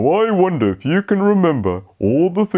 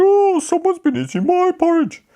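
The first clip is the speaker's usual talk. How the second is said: high Mummy Bear voice; a surprised mother bear, pitched up high. Acted. Mummy Bear's line.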